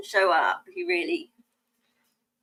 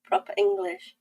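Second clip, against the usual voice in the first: mum's admonition; 9 semitones higher than usual. Retold or imitated. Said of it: "That's what my mum used to say".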